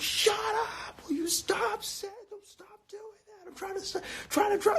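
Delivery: squeaky pretend scolding father; hammed-up high scolding dad voice; imitating dad. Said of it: "my dad would look back, be like".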